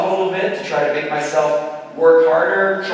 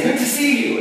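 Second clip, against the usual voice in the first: pastor mode; 9 semitones higher than usual. Retold or imitated. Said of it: "I'm kind of in pastor mode".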